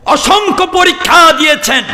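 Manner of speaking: sudden high preacher's cry; impassioned preaching. Real, not acted. Preacher telling of countless trials undergone, high impassioned cry. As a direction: fling it out high and forceful.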